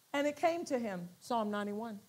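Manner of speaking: normal talking voice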